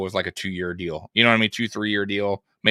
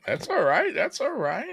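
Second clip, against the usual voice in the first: high teasing voice; 10 semitones higher, 6 dB quieter than usual. Acted. Teasing after partner's sneeze.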